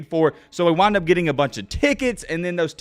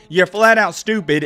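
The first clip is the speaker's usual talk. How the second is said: normal talking voice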